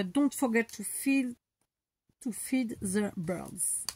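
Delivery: hesitant, slow English with a French accent; French accent, feeling out each English word. Real, not acted. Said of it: "super mal".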